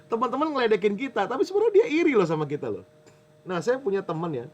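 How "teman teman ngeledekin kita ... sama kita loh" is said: laughing quickly. Real, not acted. Laughing, fast, higher.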